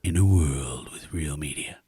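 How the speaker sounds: low, close-mic movie trailer announcer. Shown as a demonstration. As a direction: drop it deep and hushed like a trailer narrator. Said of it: "you have to do the movie voice".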